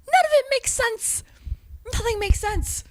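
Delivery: high-pitched exasperated whine. Real, not acted. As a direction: throw up each phrase high, in disbelief. Exasperated outburst at absurdity.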